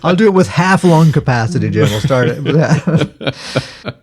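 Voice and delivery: giggling while he speaks; laughing. Real, not acted. Laughing while joking with cohost.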